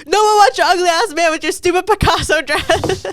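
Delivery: high, loud mocking insult; roasting the girl's ugly dress; insulting retort. Friend snapping at girl who glared. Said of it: "she's like".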